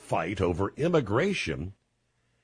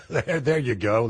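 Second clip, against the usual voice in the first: amused laughing; 2 semitones higher than usual. Real, not acted. Host amused by caller's mandatory-listening law, laughing. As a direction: chuckle through the reply.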